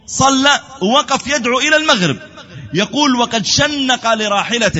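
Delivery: high, driving sermon narration; impassioned preaching. Acted. Preacher tells pilgrims of the Prophet's supplication, impassioned preaching. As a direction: tell it high and emphatic, as a preacher. Preaches the story at full force.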